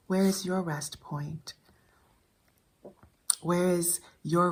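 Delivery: slow, measured poetry recital with long pauses; poem reading. Acted. Reading her poem on rest to viewers, measured poem reading. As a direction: let each short phrase settle into silence.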